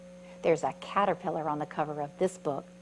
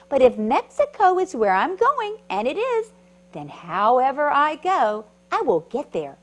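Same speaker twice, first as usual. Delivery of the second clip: excited caterpillar; 7 semitones higher, 9 dB louder than usual. Acted. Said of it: "said the creepy crawly bug".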